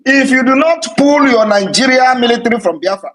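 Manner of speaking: warning soldiers to leave Biafra, loud; threatening shout. Real, not acted. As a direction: bellow the ultimatum at them. Threatening the Nigerian military.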